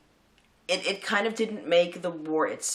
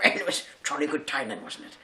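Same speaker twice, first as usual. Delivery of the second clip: breezy stiff-upper-lip chap, only lightly done; jolly English officer; 2 semitones lower than usual. Retold or imitated. English army guy brushing off the war, lightly voiced jolly officer. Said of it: "the English dude being like".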